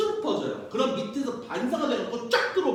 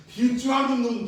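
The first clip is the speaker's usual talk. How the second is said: loud, higher playful scolding; hammed-up fake anger; mock threat; 3 semitones higher, 5 dB louder than usual. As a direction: pretend to scold the audience.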